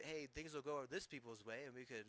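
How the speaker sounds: ordinary talk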